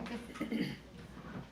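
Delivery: hushed breathy chuckle; quiet laugh. Real, not acted. Narrator laughing quietly after a slip. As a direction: laugh it off softly.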